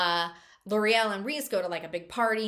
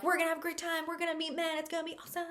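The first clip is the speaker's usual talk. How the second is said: high, giddy chatter, trailing softer; mock girlish excitement; 8 semitones higher, 4 dB quieter, much faster than usual. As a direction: imitate giddy friends anticipating fun. Acting excited girls on holiday.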